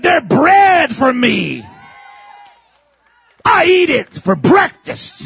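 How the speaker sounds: put-on old warrior's boast. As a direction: savor every word like a bold old man. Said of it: "He's eighty-five talking like that".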